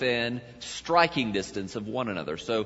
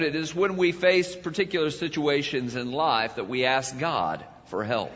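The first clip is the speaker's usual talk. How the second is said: ordinary delivery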